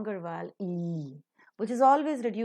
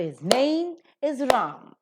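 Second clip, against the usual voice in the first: "name" and "Ram" stressed, "his" and "is" weak; slow model sentence stressing "name" and "Ram"; 4 semitones higher, 5 dB louder, slower than usual. Shown as a demonstration. Teacher models the sentence for students, stressing "name" and "Ram". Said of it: "The rest are reduced to their weak forms".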